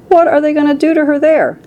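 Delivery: high, alarmed question; distressed cry. A narrator's reading. Mother fears for daughter, high distressed cry. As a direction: cry out high with volume held back. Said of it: "cried Missus Beauregard".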